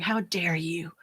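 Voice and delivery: hushed whisper of self-blame; whispered self-reproach. Retold or imitated. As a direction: whisper it at yourself, scolding. Berating herself in her mind.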